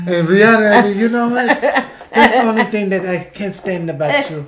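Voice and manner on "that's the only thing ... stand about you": normal talking voice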